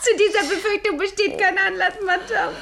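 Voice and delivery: high, bright laughter through the words; laughing reply. Acted. Girl laughing off Madame's teasing about piety. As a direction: laugh it off, amused.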